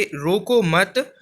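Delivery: "रोको मत" drawn out slowly, rising on "मत"; slow "रोको मत", left open before a pause; pause after मत. Shown as a demonstration. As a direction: stretch "रोको मत" and hang on "मत". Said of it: "तीसरा वाक्य पढ़ेंगे".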